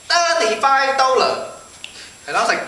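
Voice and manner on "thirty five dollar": put-on high, slow drawn-out Chinese-accented shopkeeper. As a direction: quote the price in a mock Chinese accent. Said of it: "this Chinese guy came out".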